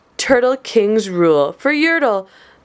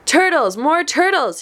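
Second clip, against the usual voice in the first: mild mock bellow; 7 semitones higher than usual. A narrator's reading. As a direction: bellow it as a storybook king, gently. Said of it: "he bellowed and brayed".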